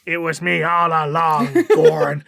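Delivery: slow, dramatic heel reveal; villain reveal. Pretend. Raffen unmasked to Gorn, slow dramatic villain reveal. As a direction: savour it like a wrestling villain.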